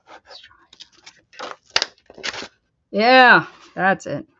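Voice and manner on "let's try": breathy whisper to herself. Real, not acted. Whispering to herself while she works. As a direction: barely voice it.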